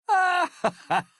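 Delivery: high, loud laugh; loud cackling laugh up high. Acted. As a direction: burst out in a high-pitched laugh. Character laughs in acted dialogue.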